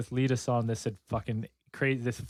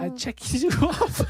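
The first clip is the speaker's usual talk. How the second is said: mock German killer; 10 semitones higher than usual. Joins German killer bit.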